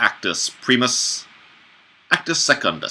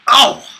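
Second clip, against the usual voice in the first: high cry; 9 dB louder than usual. Acted. Character exclaims in a play.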